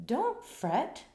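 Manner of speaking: high soothing little mouse voice; squeaky mouse. A narrator's reading. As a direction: squeak gently to comfort the bear. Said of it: "Mouse squeaks".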